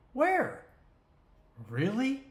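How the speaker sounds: sharp high disbelieving "where?", then "really?"; mock the claim with disbelief; incredulous scoff. Real, not acted. Mocking government smoke-free zones, incredulous.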